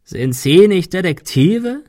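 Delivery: slow, high, croaky taunt; croaking. A narrator's reading. Claymore taunting the detectives, croaking. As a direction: croak the question with rising mockery. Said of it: "krächzte er".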